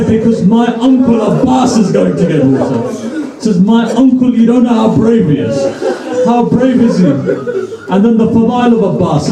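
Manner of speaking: high-pitched, fervent sermon storytelling; impassioned preaching. Acted. Preacher tells the congregation of brave young Abbas, impassioned. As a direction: preach it high and rousing, driving toward the climax.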